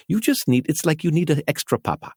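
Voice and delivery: high, hammed-up South African accent. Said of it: "I'm trying to do a South African accent".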